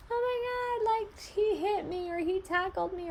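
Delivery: put-on high whiny voice of a kid tattling; upset child. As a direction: squeal like a hurt child telling on a sibling. Child complaining about sibling.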